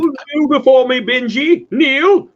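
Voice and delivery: booming, very high villain command; hammed-up tyrant's shout; General Zod. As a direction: order it like a movie supervillain. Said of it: "General Zod".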